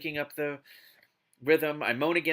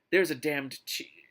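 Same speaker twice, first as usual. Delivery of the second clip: plain reading voice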